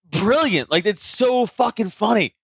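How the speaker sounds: ordinary talk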